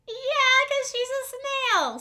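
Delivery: high excited exclamation. Real, not acted. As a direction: burst out with the answer. Excited answer to kids.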